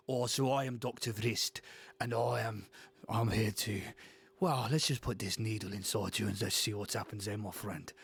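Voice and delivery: menacing South African doctor, unhurried; South African accent. Acted. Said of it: "South African".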